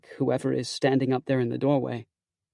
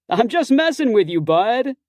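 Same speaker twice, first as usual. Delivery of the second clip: grinning; 9 semitones higher, 6 dB louder than usual. A narrator's reading. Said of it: "he says, grinning".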